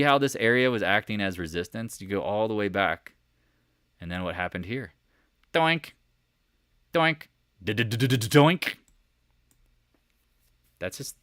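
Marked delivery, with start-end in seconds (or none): 5.5-8.7 higher, playful stuttered doink; goofy chart sound effect. Pretend. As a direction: make a cartoon bounce noise.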